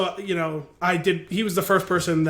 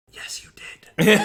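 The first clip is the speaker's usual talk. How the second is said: slow, voiceless stage whisper; imitating a girlfriend's whispered prompt; retold whisper; 2 semitones higher, much slower than usual. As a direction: whisper it slowly, as if into his ear. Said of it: "like, whispering in his ear".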